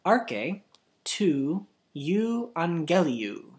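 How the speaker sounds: Arche, then tu euangeliu drawn out slowly; slow it right down for a big word. Shown as a demonstration. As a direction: stretch each syllable of euangeliu for the learner. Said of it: "so I'll slow it down".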